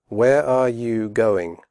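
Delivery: slow with full are; careful slow question; slowly. Shown as a demonstration. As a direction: say each word clearly, slowly. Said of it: "first slowly and then quickly".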